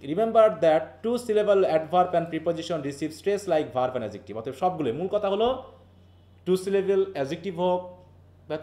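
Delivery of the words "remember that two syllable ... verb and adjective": slow, deliberate textbook reading; reading rule aloud. Acted. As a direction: read the rule slowly to the class. (ধীরে বই পড়ে শোনানো)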